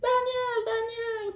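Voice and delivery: high call of Daniel; sing-song high call for Daniel. Acted. Calling out for Daniel.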